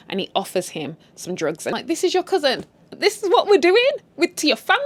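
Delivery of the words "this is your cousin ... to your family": high-pitched, appalled questioning; incredulous scolding. Acted. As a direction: scold them in mock disbelief. Scolding a character in disbelief.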